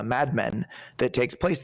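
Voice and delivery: ordinary delivery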